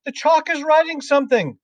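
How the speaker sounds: high, loud schoolboy amazement; hysterical, very high-pitched cry; screaming. A narrator's reading. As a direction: shriek the news to the whole class. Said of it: "screamed Nigel".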